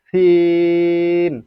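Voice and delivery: সিন with a very long held ee; সিন drawn out for three beats; elongated vowel. Shown as a demonstration. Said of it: "তিন আলিফ পরিমাণ টানতে হবে".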